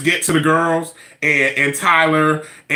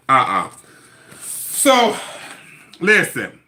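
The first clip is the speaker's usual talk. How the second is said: normal talking voice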